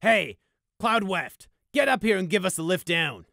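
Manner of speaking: loud, high call across the room. A narrator's reading. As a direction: call out to a comrade below. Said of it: "then called out".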